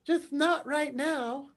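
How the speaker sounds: put-on high, slow little-kid voice; three-year-old boy. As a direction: imitate a toddler answering innocently, drawn out. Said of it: "who at the time was three".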